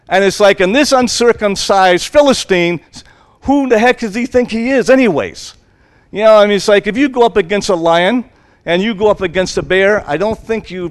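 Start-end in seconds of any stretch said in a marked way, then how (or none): 0.6-5.5 higher, indignant scoff; defiant young shepherd scoffing; scornful challenge. Acted. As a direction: sneer at the giant's nerve. David scoffing at Goliath.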